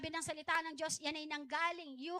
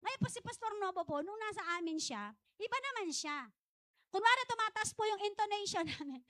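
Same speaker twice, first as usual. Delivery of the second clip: normal talking voice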